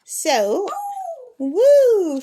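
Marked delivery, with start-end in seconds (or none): 1.4-2.2 high whooping call; bird call imitation. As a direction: hoot it high and loud. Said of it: "Smokey says".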